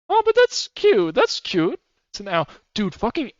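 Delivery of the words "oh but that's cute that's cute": delighted coo. Real, not acted. Sudden delight at cute owl.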